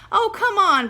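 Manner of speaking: high, loud eager protest; big-sister plea, loud and high. Acted. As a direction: push back, all excited. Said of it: "protested Judy".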